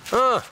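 sharp high cry of surprise; startled loud ah; surprised shout. Real, not acted. Sudden cry at a find.

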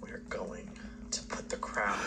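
whispering. Pretend. Evil scheming at the crown.

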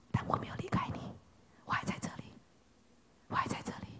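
soft, unvoiced tender whisper; God's gentle whisper. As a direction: whisper as God reassuring the runaway. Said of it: "用温柔微小的声音告诉我们说". (上帝对以利亚温柔低语)

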